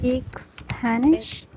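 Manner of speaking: slow, with the voice rising on Spanish; rising question tune. Shown as a demonstration. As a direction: lift the end of speak Spanish so it asks. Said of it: "the tone of your voice is going up".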